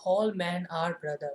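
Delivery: very slow, word by word, for note-taking; dictating at a crawl; slow dictation. Shown as a demonstration. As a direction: spell it out slowly so the class can write it down. Teacher dictating an example sentence.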